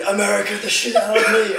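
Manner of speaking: high, loud yell through laughter; retold scream. Man re-enacting his drunken outburst to his mates, screaming. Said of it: "I was just screaming".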